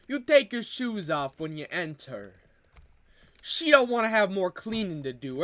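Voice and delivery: broad mock Italian-American accent, playing a wiseguy. Comedian riffing on family life in an Italian accent. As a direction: lay on a thick Italian accent like a mob cousin. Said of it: "the Italian accent".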